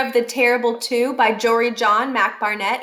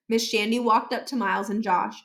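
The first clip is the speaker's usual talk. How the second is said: plain reading voice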